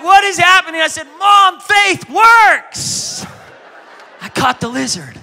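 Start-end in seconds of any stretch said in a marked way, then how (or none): 0.0-0.8 loud, high startled question; alarmed mom. Retold or imitated. Mom in the prayer meeting shouting in alarm at the chaos. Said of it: "My mom was like". 1.2-3.2 high, loud, very slow proud declaration; triumphant child. Retold or imitated. Little girl proudly telling mom that faith works, drawn out and loud.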